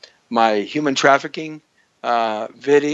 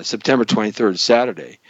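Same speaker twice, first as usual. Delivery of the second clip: ordinary delivery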